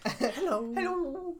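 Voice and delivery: high creepy clown hello; eerie clown calling out; Pennywise voice. Acted.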